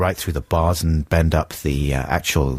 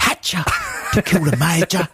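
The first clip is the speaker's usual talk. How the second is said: mock American accent; 9 semitones higher than usual. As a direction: parrot the actor's odd American twang, pitched up. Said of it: "sounds like he's from America there".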